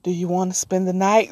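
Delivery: soft, eerie little-twin voice; lightly voiced creepy kid inviting a sleepover; a hint of the creepy Halo twins; creepy child voice. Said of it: "that's the tone of voice it was in".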